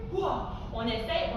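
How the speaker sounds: normal talking voice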